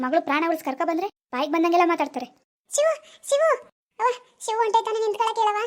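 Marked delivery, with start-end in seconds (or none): 2.7-5.6 put-on higher girl's voice, calling out after Shivu; daughter calling out. Daughter calls after Shivu as he leaves, higher pleading voice. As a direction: call him back, then beg mother.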